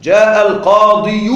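slow, loud القاضي ending in -yu, al-qāḍiyu; pronounced damma. Shown as a demonstration. Teacher showing why it is heavy, القاضي as al-qāḍiyu. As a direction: push out the laboured "yu" on القاضي. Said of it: "المفروض ننطق ضم على الياء".

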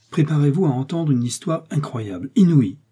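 high, emphatic build-up; agitated warning of astonishing news; dramatic announcement. A narrator's reading. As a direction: build to a loud last word. Breathless announcement of shocking news.

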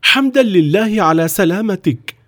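high-pitched cry of relief; overjoyed father, voice pitched right up; relieved exclamation. A narrator's reading. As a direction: exclaim like a father embracing his rescued son. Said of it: "وهو يهتف".

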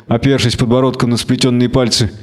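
ordinary delivery

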